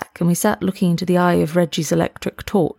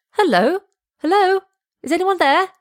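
shouting; 13 semitones higher than usual. Acted. Reggie searching the house, shouting higher to find them. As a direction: call out across the dark house. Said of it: "young Reggie's voice shouted".

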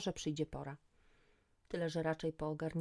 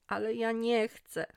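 higher, louder, drawn-out whine; loud wailing; 6 semitones higher, 6 dB louder, slower than usual. A narrator's reading. Magda wailing to her friend on the floor, loud whine. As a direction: wail it out slowly in protest. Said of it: "zawodziła już głośno".

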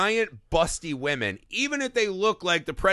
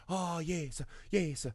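soft, higher, sultry mock Italian; Monica Bellucci; 3 semitones higher, 9 dB quieter, slower than usual. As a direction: sigh it like a put-on Italian seductress. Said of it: "like, inner Monica Bellucci".

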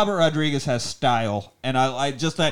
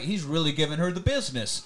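usual speaking voice

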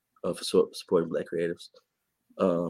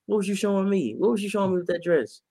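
incredulous mock question; 12 semitones higher than usual. Mocking someone's outfit.

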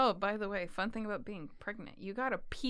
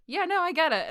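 coworker voice; 8 semitones higher, 9 dB louder than usual. Retold or imitated.

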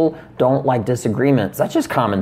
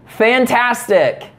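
slow, higher "Fantastic" with a fake smile; sarcastic fantastic; 8 semitones higher, much slower than usual. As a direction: drag out "Fantastic" high and slow, icy. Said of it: "You see, you can't do it".

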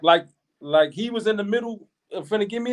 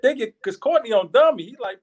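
ordinary talk, nothing marked

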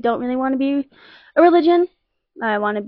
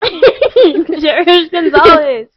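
announcer voice; 5 semitones higher, 8 dB louder, much slower than usual. Acted. Host announces guest with laugh.

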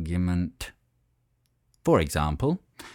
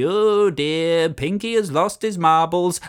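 laughing at him; 7 semitones higher, 5 dB louder than usual. Acted. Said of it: "Some simply laughed at Pinky".

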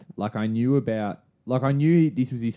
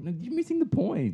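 much higher playful retort; high mock retort. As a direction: squeak it back in protest. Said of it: "I was like".

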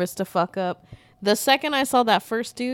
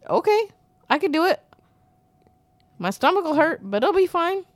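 high-pitched, hammed-up anxious agreeing; mock compliance; 8 semitones higher, 3 dB louder, faster than usual. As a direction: play an over-accommodating rule follower, pitched high. Acts out pleading compliance with rules.

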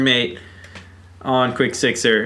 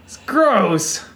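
higher, disgusted mom voice; complaining mom; 12 semitones higher than usual. Retold or imitated. Mom complaining to son about drain, mild mocking whine. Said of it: "she'd be like".

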